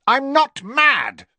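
loud, high, drawn-out shout; angry high-pitched outburst; furious protest. A narrator's reading. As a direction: shout back in outraged denial. Riddle's furious protest, narrated scene.

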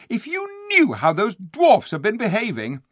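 high, loud outrage; indignant cry. A narrator's reading. Eustace complaining of the dwarfs, indignant. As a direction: protest hotly against the idea. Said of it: "cried Eustace".

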